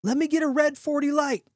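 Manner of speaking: high-pitched holler across a bar; yelling an order. A narrator's reading. Excited man yelling for the bartender, high holler. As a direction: holler for a drink over the crowd. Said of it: "yells for the bartender".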